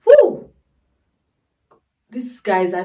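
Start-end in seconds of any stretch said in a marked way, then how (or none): 0.0-0.5 high loud whoop; real excited whoop; joyful whoop. Real, not acted. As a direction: let out a thrilled woo. Joyous cheer.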